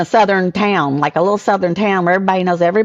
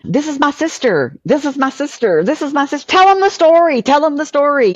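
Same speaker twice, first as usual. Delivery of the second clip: proud brother introducing; 9 semitones higher than usual. Retold or imitated. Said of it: "Michael's just taking me around everywhere and saying".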